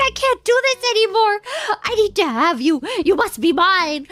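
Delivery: loud, hammed-up possessive outburst; desperate declaration. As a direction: declare it as a lovesick villain. Acted desperate declaration of love.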